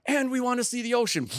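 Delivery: a mock-giddy teen announcing a road trip; excited kids. Sons announcing a road trip, mock-giddy. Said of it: "And then they're like".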